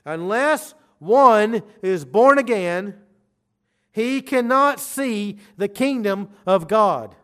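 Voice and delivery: slow urgent warning from the pulpit. Real, not acted. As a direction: drive it home slowly and urgently. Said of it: "I say it very urgently".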